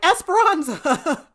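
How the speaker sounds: a slow, hammed-up shout; calling out. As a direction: bellow the name across the room. Ray calls out in gunfight.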